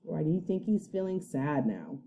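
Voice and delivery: ordinary delivery, nothing marked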